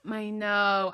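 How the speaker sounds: ordinary delivery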